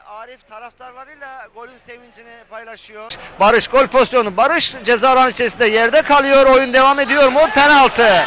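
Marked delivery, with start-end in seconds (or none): 3.4-8.2 screaming football commentator. Real, not acted. Penalty incident called live.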